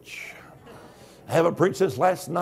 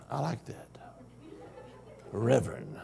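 much softer and lower, half whispered; savoured whisper; 11 semitones lower, 15 dB quieter than usual. Real, not acted. Preacher savouring being called Reverend, softly. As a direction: relish the title under your breath.

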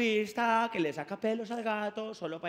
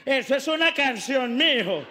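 louder teasing wife; wife catching husband reciting a song; amused wife; 3 semitones higher, 7 dB louder than usual. Retold or imitated. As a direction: call him out on the song. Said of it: "Y ella".

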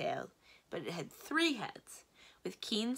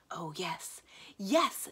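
delighted, building on the repeat; happy; much slower than usual. A narrator's reading. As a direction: agree happily, lifting the second yes. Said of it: "Aramin said happily".

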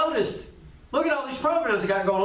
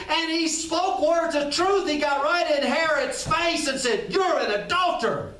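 fiery preaching; 5 semitones higher than usual. Acted. Preaching at full force.

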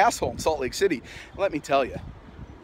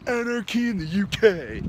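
mock poser chanting a slogan, higher pitch; posing fool; 6 semitones higher than usual. Punk mocking posers who parrot slogans, mock poser voice. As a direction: put on a fake punk's sloganeering cry. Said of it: "If they were real fools, they'd say".

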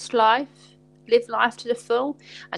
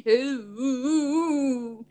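drawn-out wailing ooh; sustained crooned ooh; long sung ooh. Pretend. Ends the song.

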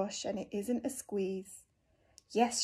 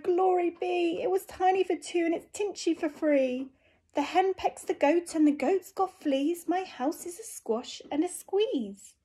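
put-on distressed old lady; 6 semitones higher than usual. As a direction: wail about the cramped house. Lady cries out.

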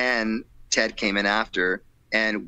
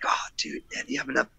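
hushed, secretive whisper; 4 dB quieter, faster than usual. Retold or imitated. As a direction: whisper it like nobody should hear. Broke friends secretly counting money.

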